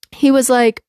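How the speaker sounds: ordinary talk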